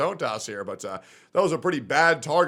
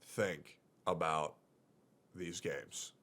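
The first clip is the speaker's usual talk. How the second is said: quiet resigned; 5 semitones lower, 6 dB quieter than usual. Real, not acted. Resigned mock martyr to chat.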